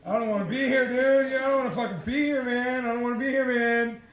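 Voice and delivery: high, loud, rushed protest; retold panicked plea. Telling his guide he wants out, panicked plea.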